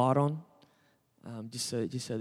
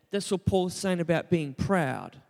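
ordinary delivery

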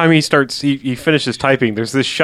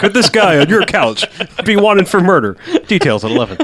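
higher, louder mock news-teaser voice; news anchor; 6 semitones higher, 5 dB louder than usual. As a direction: ham up the breaking news tease.

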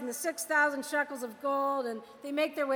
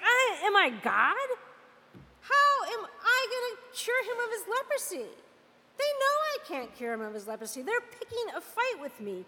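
frantic, higher-pitched protest without real shouting; mock outraged screaming; 6 semitones higher than usual. King to his servants about the letter, mock outrage. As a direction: act out a panicked royal tantrum, pitch jumping up. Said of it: "screaming at his servants".

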